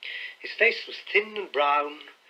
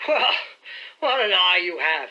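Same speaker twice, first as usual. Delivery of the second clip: relieved cry; 4 semitones higher, 5 dB louder than usual. A narrator's reading. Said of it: "he cried with a sigh of relief".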